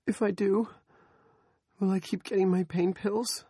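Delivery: high, timid plea; pleading woman. Acted. Andrea bargaining for her pills, high and timid. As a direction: ask hopefully, small.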